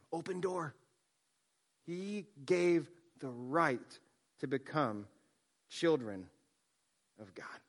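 low, slow, reverent verse reading falling to a hush; softly reverent scripture, trailing away. Acted. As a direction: read it softly as sacred text. Reads scripture in hushed voice.

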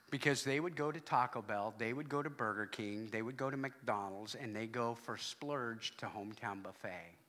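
ordinary talk, nothing marked

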